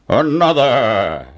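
slow, stretched giant command; drawn-out giant voice; giant's order. Acted. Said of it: "ordered the giant".

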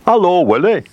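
high, loud storybook hail; swordfish character. A narrator's reading. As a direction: hail a friend as a cheery storybook fish. Swordfish greets the trapped whale.

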